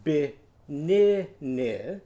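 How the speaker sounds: "Banana" stretched out very slow, each syllable drawn. Shown as a demonstration. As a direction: draw out "Banana" slowly. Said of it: "Your turn".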